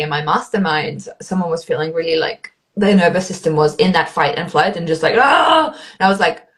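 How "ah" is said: sudden high mock panic yelp; panicked cry. Coach imitating an overwhelmed client, high panicked yelp. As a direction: squeal like a frazzled nervous system.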